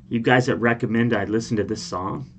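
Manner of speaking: usual speaking voice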